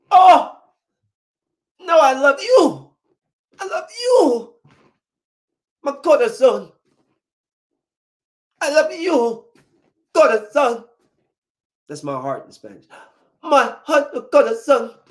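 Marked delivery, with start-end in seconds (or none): 0.1-10.9 high, drawn-out mock lovesick pleading; hammed-up passion, pitched way up; passionate declaration. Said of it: "They say it with so much passion". 13.4-15.0 high mock-lovesick cry; hammed-up romantic ex; passionate declaration. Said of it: "They say it with so much passion".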